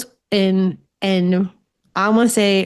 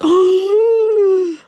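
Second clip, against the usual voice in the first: high, loud shocked gasp; 12 semitones higher, 4 dB louder than usual. Retold or imitated. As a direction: gasp as if startled hard. Customs officer reacts to medium.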